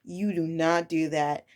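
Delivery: low, measured telling-off; calm, controlled no-nonsense correction; firm teacher. Retold or imitated. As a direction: lay it down quietly to a small child. Teacher firmly correcting a child.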